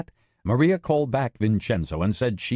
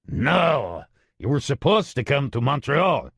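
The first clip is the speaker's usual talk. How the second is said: gruff gravelly voice; 6 semitones higher than usual. Acted. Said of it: "a very gruff, gravelly voice".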